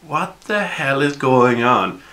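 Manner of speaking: slow, high-pitched bewilderment; acted baffled outburst; startled disbelief. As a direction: draw it out high, as if caught off guard. Startled thugs react to the voice.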